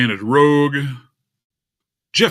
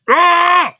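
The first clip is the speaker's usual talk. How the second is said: sharp high yell of frustration; frustrated shout; 7 dB louder than usual. Real, not acted. Streamer fed up with broken screen share, yelling. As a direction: cry out in sudden annoyance.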